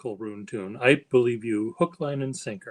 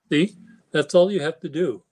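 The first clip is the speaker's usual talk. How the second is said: ordinary talk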